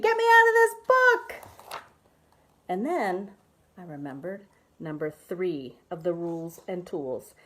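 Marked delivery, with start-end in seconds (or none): 0.0-1.3 high panicked scream. Acted. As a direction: shriek it out in a high voice. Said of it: "and I screamed".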